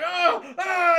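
loud, high cry of pain; pained scream. Retold or imitated. Robot lizard losing a hand, screaming in pain. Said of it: "he is screaming though".

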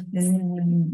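long voiced "zzz" buzz; tongue to teeth, let it vibrate. Shown as a demonstration. Teacher showing students the third ending, buzzing z. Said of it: "chạm vào cái răng".

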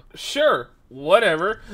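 high, loud mock agreeableness; flippant brush-off. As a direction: breezily wave off the complaint. Retold brush-off to angry customer.

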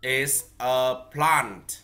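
is a plant, dragged out very slowly; slow model phrase. Shown as a demonstration. Teacher models the phrase for the child to repeat, very slowly. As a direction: spell it out slowly so the child can copy. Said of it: "Con đọc đi ạ".

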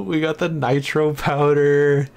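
drawn-out, rising hype; hyped up. Real, not acted. Streamer hyped to blow things up, excited. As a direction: draw the words out, pumped for explosions.

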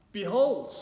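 loud, high mock shout of Behold; mock yell. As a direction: yell "Behold" after a thief in a pretend scene. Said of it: "you probably wouldn't yell out".

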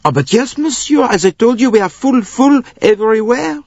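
put-on high, excited accented official; insistent conductor. A narrator's reading. Conductor tells Bouc they are full, high and insistent. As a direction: insist helplessly at a high pitch.

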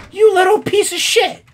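high loud furious insult; high furious curse; angry outburst. Real, not acted. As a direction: spit it out at the thief. Angry at thief character.

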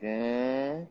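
drawn-out monotone "ehh" of someone checked out; droning, lifeless noncommittal groan; toneless "eh". Shown as a demonstration. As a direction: hold a dull "eh" with no melody. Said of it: "My tone of voice will be like".

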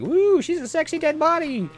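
high, squeaky robot impression. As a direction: voice the robot leering at the body. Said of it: "Like he was like".

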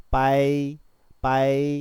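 빠이 stretched long with a tense initial ㅃ; reading demonstration. Shown as a demonstration. Teacher shows how to read 빠이, long and level. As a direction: sound out 빠이 slowly on a level pitch. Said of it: "이렇게 읽는 겁니다".

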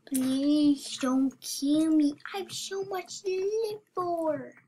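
drawn-out, hammed-up begging; mock pleading. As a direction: stretch out a playful plea for mercy. Begging a killer in game.

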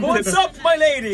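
high, loud mock suitor; hammed-up gentleman voice. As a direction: play the fedora gent.

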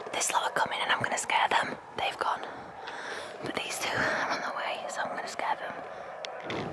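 soft, unvoiced hiding-place whisper; conspiratorial whisper. Real, not acted. Hiding to scare the walkers, sneaky whisper. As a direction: whisper so they don't hear you coming.

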